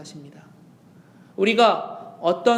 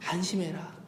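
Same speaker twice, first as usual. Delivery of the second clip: soft gentle voicing; 2 semitones lower, 12 dB quieter than usual. Preacher voices Jesus softly.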